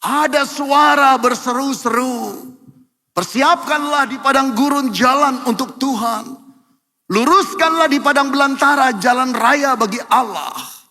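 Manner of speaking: ringing prophetic proclamation; proclaiming scripture. Acted. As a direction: cry it out like a herald in the desert.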